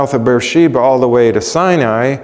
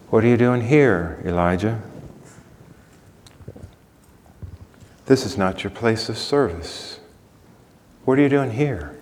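gentle; 6 dB quieter than usual. Acted. God's gentle voice to Elijah.

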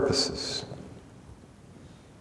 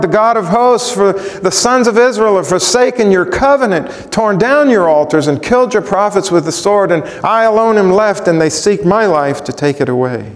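impassioned plea; 9 semitones higher, 3 dB louder than usual. Acted. Elijah repeats his complaint.

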